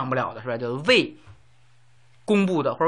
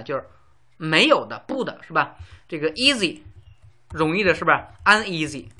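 normal talking voice

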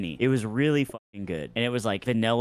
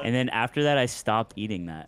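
usual speaking voice

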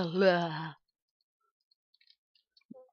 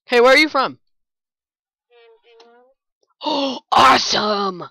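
excited; 5 semitones higher, 4 dB louder than usual. Real, not acted. Greeting chat player mid-chase.

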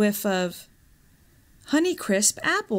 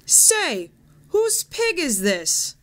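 higher, slow, taunting crook; mocking thief; 8 semitones higher, slower than usual. A narrator's reading. Thief taunting the pig at his table, higher mocking voice. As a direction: jeer at the pig, drawing it out.

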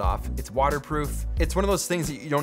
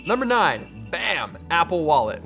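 top ten countdown; 3 semitones higher, 4 dB louder than usual. Acted. Countdown announcement.

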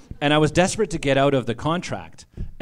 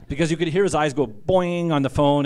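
usual speaking voice

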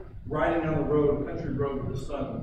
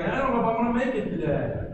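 higher, put-on voice of someone barely awake; groggy mock complaint; 6 semitones higher, 4 dB louder than usual. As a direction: whine it like a person dragging themselves out of bed. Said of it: "You climb out of bed like".

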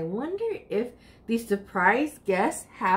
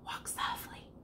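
whispered instruction; 17 dB quieter than usual. A narrator's reading. Aunt telling the child to tread quietly, whispered. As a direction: breathe it out, no voice. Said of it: "she says".

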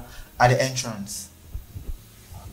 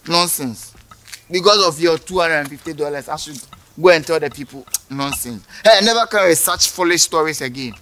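ordinary delivery

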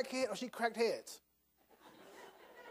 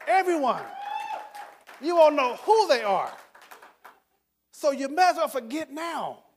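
louder and higher, preaching the point; emphatic build; 6 semitones higher, 5 dB louder than usual. Real, not acted. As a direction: drive it home to the crowd.

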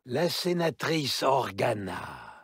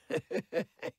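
whispered wicked laugh; soft gloating cackle; breathy cackle; 7 semitones higher, 4 dB quieter than usual. Acted. Villain laughs between lines.

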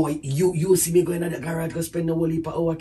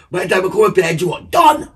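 louder, insisting it was a joke; loud protest; 2 semitones higher, 7 dB louder than usual. Real, not acted. Husband to wife, loudly protesting it was only a joke. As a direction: push the words out loud to end it.

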